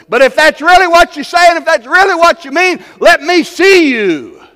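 high, loud, pleading sermon cry; fiery preacher pleading for God's power; full-force preaching. Acted. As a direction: preach it at the top of your voice. Preaching climax returns.

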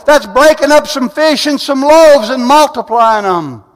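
loud, high, driving sermon voice; full-force preaching. Acted. Preacher rousing the congregation with full-force preaching. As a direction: preach it out, building fire.

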